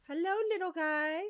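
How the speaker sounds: loud high cooing greeting; cooing to a pet. Real, not acted. Greeting creature tenderly.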